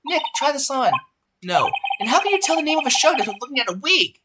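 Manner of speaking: high, gushing sidekick; excited girl voice. Acted. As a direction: gush over the wig like a fan. Voices Maya and Nick.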